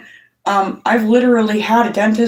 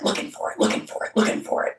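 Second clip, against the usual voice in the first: fading to a whisper; 8 dB quieter, faster than usual. Acted. Telling viewers about a restless brain, whispered fade. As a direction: repeat it dropping into a breathless whisper.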